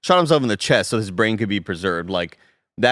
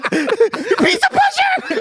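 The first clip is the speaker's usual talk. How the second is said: very high, slow, louder laughing yell; shrieking slow laugh-shout; 5 dB louder, much slower than usual. Pretend. Teasing friend about the fight, slow shrieking yell. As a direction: drag it out in a shriek. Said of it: "I was expecting more like".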